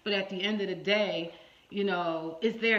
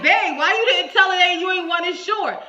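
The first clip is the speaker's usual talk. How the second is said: husband on the phone; 8 semitones higher, 10 dB louder than usual. Retold or imitated.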